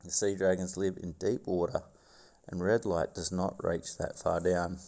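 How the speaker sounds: documentary narrator voice; nature documentary. Acted. Reads documentary script.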